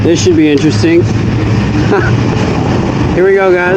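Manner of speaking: excited, muffled in a helmet; nervous excitement under the helmet; inside a motorcycle helmet. Real, not acted. Let me put my visor up.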